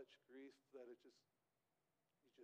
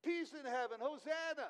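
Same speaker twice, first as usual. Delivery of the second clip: much higher and louder, jubilant cries; crowd shouting praise; 9 semitones higher, 11 dB louder than usual. Retold or imitated. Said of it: "The people are shouting".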